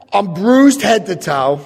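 ordinary talk, nothing marked

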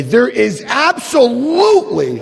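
"absolutely" stretched out high; emphatic refusal. Retold or imitated. Man back in Washington refusing, emphatic high protest.

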